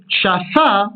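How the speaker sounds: shafa with the weight on fa; accent the final syllable of shafa; shaFA, ending stressed; stress on last syllable. Shown as a demonstration. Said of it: "se prononceront de la même manière".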